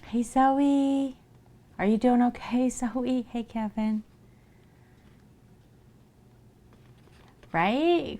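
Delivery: high, loud cheery hellos; happy high shout-out; excited greetings. Real, not acted. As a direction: greet each friend brightly.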